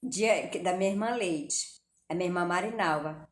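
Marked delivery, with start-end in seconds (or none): none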